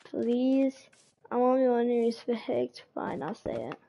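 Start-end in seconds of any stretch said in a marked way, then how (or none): none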